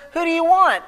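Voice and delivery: high-pitched, direct challenge; confident challenge. Acted. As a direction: confront them head-on with the question. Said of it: "looks the soldiers in the eye".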